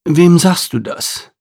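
drawn-out knowing agreement; haughty Arabian stallion; proud horse. Acted.

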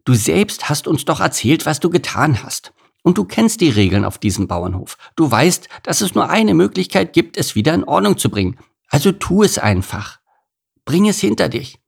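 high-pitched goose character, persuading; conciliatory, high goose voice; reasonable goose. A narrator's reading.

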